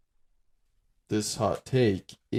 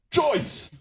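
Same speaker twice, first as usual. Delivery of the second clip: silly shout; 3 dB louder than usual. Acted. Joking name shout.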